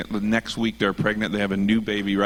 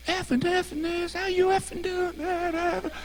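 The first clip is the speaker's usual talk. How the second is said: much higher put-on cursing rocker voice; imitating the singer; 3 dB quieter than usual. Mocking his cursing singer to the host, high falsetto. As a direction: mimic the singer in a squeaky falsetto. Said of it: "But he's just like".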